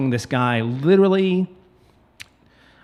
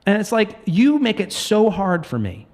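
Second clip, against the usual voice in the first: heated rant; 7 semitones higher than usual. Real, not acted. Ranting about realtors.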